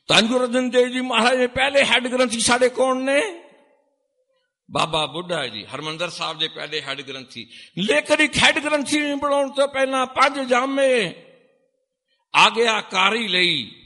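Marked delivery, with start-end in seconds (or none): 0.1-3.4 high, loud, ringing sermon voice; preaching at full force. Acted. 7.8-13.7 high, loud, ringing sermon voice; preaching at full force. Acted.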